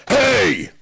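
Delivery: sudden call-out. Retold or imitated. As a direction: mimic someone calling out to get attention. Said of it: "all of a sudden, we hear this".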